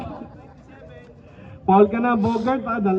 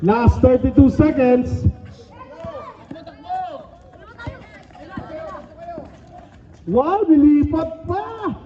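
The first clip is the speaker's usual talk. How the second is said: stretched-out names and score calls; live play-by-play; 4 semitones higher, slower than usual. Real, not acted. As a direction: call each basket like a courtside announcer.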